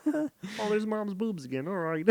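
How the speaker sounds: put-on high, soft-edged puppet kid; Kermit voice. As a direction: imitate a boy in a squeaky puppet voice. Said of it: "Why do you give him a Kermit voice?".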